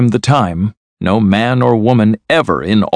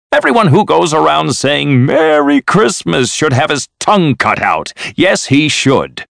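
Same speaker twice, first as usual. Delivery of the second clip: indignant tirade, pitched up; angry rant; 6 semitones higher than usual. A narrator's reading. Uncle ranting at his nephew about Christmas, angry and indignant. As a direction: rail crossly with a raised pitch. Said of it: "Of course I'm angry".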